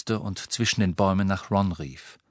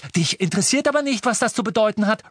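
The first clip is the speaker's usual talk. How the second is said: high, fast accusation; angry interruption; 12 semitones higher, 4 dB louder, faster than usual. A narrator's reading. Ron interrupting Harry in a quarrel, angry accusation. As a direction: cut in hotly.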